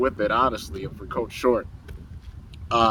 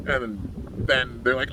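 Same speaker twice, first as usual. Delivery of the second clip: usual speaking voice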